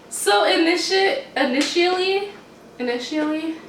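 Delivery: initially said as ini-shlee, high and hesitant; stumbling over initially. Real, not acted. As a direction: trip over "initially", dropping the middle syllable. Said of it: "How do you say it?".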